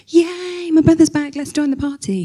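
high, bright mock "yay"; hammed-up happy brother; mock cheer.

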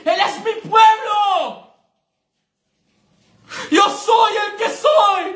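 preacher shouting God's words against Satan; preaching at full force. Acted. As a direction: roar God's claim over the enemy.

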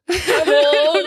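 laughing mimic. Real, not acted. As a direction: laugh through the words. Laughing echo of grandma.

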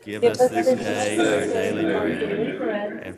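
unison prayer recitation; reciting a prayer. Acted. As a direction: pray it aloud with the congregation. Congregation prays the Lord's Prayer.

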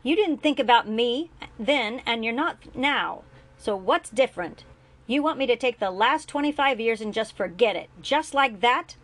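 loud, high, bitter accusation; angry accusation. A narrator's reading. Julian to his father, angry and loud. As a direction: throw it in his face.

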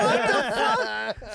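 What the fuck yelled high and loud; a loud shocked yell. Retold or imitated. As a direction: blurt it out in disbelief. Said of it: "And I'm like".

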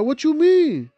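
high, feigned innocent question; mock the friend's fake innocence; playing innocent friend. The friend dodging the accusation, high mock-innocent voice. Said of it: "This dude's like".

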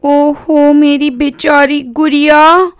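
slow, high, drawn-out cooing; tender high-pitched sister voice; loving coaxing. A narrator's reading. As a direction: coo pityingly as a little sister to her doll. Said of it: "प्यार से फुसलाते हुए".

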